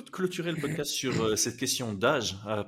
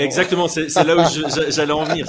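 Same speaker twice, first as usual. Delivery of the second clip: excited; 5 semitones higher, 11 dB louder than usual. Real, not acted. Excited agreement, loud.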